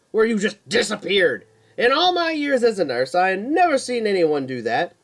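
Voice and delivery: high, loud scolding nurse; loud, high-pitched indignant nurse; angry nurse. Acted. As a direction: tear into him like an angry nurse. Nurse confronting Keith angrily.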